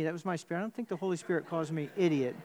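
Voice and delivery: usual speaking voice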